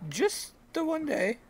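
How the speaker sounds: odd farmer voice. Acted.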